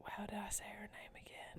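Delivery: whispered aside; breathy unvoiced whisper, barely audible. Real, not acted.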